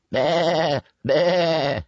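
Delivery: high imitated sheep baa; sheep's bleat. A narrator's reading. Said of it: "bleated the sheep".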